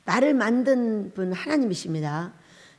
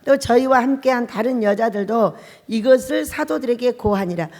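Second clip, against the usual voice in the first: loud, measured Bible reading; scripture reading; 6 dB louder than usual. Acted. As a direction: read the verses out like a preacher. Reads scripture aloud.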